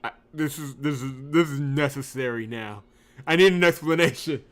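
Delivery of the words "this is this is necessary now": ordinary talk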